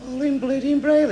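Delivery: high, exasperated repeat; incredulous echo. Acted. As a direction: throw it back in disbelief. Passenger repeats answer in disbelief.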